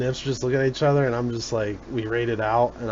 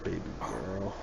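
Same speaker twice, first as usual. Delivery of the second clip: slow, very low and soft tease; dad voice; 6 semitones lower, 14 dB quieter, slower than usual. Pretend. Answering "you stop" with a dare, dad voice. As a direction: drop deep and quiet, drawing it out.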